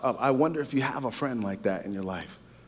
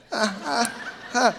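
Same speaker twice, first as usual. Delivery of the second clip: short real laugh; 9 semitones higher, slower than usual. Real, not acted. Laughing at himself.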